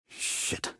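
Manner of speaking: very soft, voiceless swear; barely audible whispered curse. Acted. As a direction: hiss the curse while hidden. Sniper curses under his breath.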